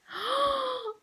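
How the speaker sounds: sharp high gasp of delight; real gasp of delight. Real, not acted. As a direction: gasp as the toy appears. Unboxing a cute toy.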